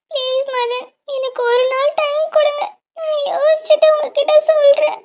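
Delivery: high, tearful begging for time; crying plea. Acted. Pressed to elope now. (அழுகையுடன் கெஞ்சல்)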